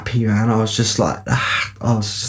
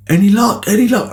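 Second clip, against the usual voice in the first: high, chirpy phone-caller impression; pitched-up, keen questioning; eager friend imitation; 10 semitones higher, 4 dB louder than usual. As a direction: mimic a mate eagerly checking in, much higher. Voicing his friend's eager phone call.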